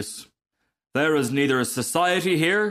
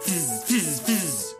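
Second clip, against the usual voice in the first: hissing firework fizz; imitated fizzing; 5 semitones higher, much slower than usual. Rocket igniting mid-boast, imitated fizzing. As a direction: fizz like a lit fuse.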